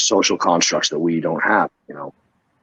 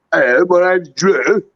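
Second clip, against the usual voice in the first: high, slow, sing-songy mock Scouse; thick Scouse accent; 11 semitones higher, 5 dB louder, slower than usual. British wrestling camper introduces himself, thick mock Scouse. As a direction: exaggerate a rolly Scouse lilt, loudly. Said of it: "super thick sort of Scouse British accent".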